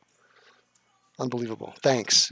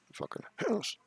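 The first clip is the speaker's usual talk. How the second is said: squeaky, much higher mocking repeat; mock echo; 9 semitones higher, 10 dB quieter than usual. Streamer mockingly echoes the chat name, high and squeaky. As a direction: toss it back in a high silly voice.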